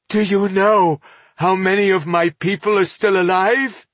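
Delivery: high, strained despair, fully voiced; despairing question. A narrator's reading. Elf asks dwarf about his surviving people, despairing. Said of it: "he whispered in despair".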